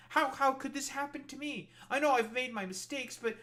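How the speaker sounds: high, pleading mock victim; whiny put-on voice of a shocked regretter. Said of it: "shocked Pikachu face and be like".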